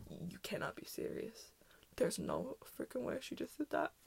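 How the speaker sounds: whispered disbelief; stunned whisper. Retold or imitated. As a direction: hush it, stunned. Disbelief to mom.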